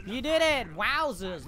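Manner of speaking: high, loud triumph; delighted whoop; victory cheer. Real, not acted. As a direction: cheer the win.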